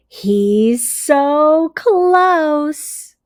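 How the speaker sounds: slow, drawn-out suspense; suspenseful. A narrator's reading. Teacher building suspense for the class, drawn out slowly. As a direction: hold it back to build tension.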